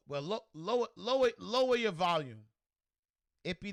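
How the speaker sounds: normal talking voice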